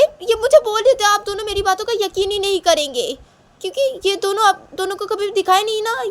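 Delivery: put-on very high, loud, insistent child voice; agitated tattling girl. Shanaya reports Tisha's smirk to parents, agitated. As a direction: tell on them urgently to your parents. Voices daughter telling on siblings.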